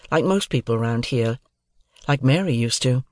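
ordinary delivery, nothing marked

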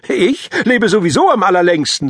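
lightly voiced cocky boy; boastful kid. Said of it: "sagte Paavo".

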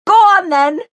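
very high, loud shriek. A narrator's reading. Mary shrieking after Jace as he walks off. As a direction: shriek it at his back. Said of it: "Mary shrieked at his back".

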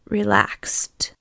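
hoarse whispered word; scratchy whisper. Acted. Strapped-down patient echoing the EMT, hoarse whisper. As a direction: rasp it out, barely voiced. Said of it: "scratched out".

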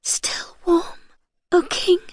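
high, whispery gasp; faint gasp. A narrator's reading. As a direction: gasp it out high, numb with cold. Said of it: "she could just gasp".

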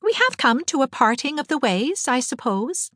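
put-on high, lightly pensive girl's voice; thoughtful. A narrator's reading. Anne reflecting with Diana on parting, thoughtfully. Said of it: "said Anne thoughtfully".

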